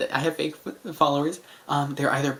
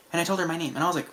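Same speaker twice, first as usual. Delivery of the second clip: usual speaking voice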